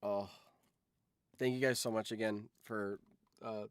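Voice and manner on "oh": usual speaking voice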